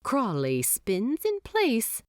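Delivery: usual speaking voice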